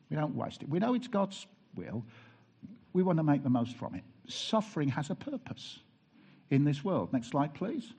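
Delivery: ordinary delivery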